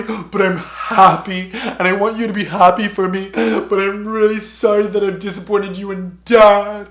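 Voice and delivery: loud, high mock-sobbing apology; wailing imitation of crying to mom; crying and rambling. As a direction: blubber through a hammed-up tearful confession. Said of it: "crying and rambling and just saying everything".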